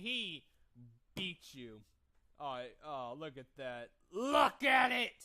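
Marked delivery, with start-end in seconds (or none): 4.1-5.1 loud, slow, higher shout; shouting at the replay; excited shout. Real, not acted. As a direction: yell at the screen in glee.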